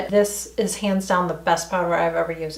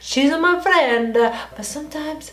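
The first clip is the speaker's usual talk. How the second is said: higher-pitched, hammed-up Italian accent; 5 semitones higher than usual. Shown as a demonstration. Vlogger griping about her best friend, mock Italian accent. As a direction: play up a sing-song Italian accent. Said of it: "I can do an Italian accent".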